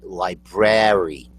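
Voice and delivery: library as LIE-BRARE-ee; correct library. Shown as a demonstration. Host correcting, LIE-BRARE-ee for library. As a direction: sound both Rs in library. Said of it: "there's an R in there".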